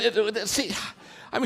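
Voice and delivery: ordinary talk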